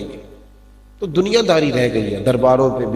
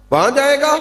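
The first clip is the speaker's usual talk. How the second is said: high, challenging uncle's question; incredulous uncle; 11 semitones higher, faster than usual. Acted. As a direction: throw the question back in disbelief. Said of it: "چچا کہنے لگا".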